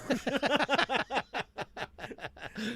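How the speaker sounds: laughing, breaking character; burst of laughter. Real, not acted. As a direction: crack up mid-bit.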